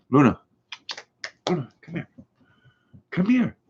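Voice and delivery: high sing-song coaxing, rising on the second come here; coaxing the dog. Real, not acted. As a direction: lure the dog over in a high voice.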